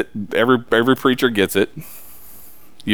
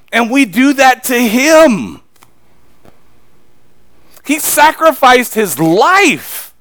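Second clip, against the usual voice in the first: slow, loud, higher sermon climax; slow fervent preaching; 11 semitones higher, 7 dB louder, much slower than usual. Acted. Preacher describing the crucifixion, slow and fervent. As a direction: lean on every word of the sacrifice.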